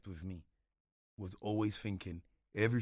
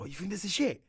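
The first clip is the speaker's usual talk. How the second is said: indignant; 10 semitones higher, 7 dB louder than usual. Retold or imitated. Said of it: "but then I'll be like".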